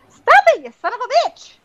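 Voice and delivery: high, loud yelp at a biting pet; yelling at the pet. Real, not acted. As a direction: shriek at the cat to stop. Cat still biting her.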